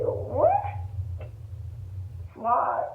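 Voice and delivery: normal talking voice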